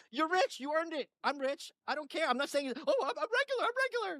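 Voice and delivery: very high, put-on rich lady voice; mock Barbara Walters. Barbara insisting she's regular, very high mock voice. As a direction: squeak it out like a pampered socialite. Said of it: "She's like".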